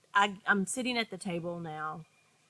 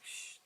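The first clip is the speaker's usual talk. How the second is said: soft shush; 19 dB quieter than usual. Retold or imitated. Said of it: "It was like".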